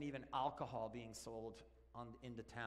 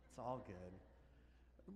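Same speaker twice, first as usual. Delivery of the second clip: soothing reassurance; 6 semitones lower, 9 dB quieter, much slower than usual. Acted. Soothing repeat to audience.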